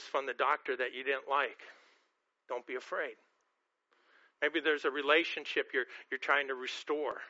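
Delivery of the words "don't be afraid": gentle reassurance. Real, not acted. Pastor comforting hurting listeners, softly reassuring. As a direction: speak it softly, like a hand on a shoulder.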